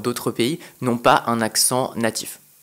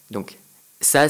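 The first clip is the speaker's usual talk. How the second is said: ordinary delivery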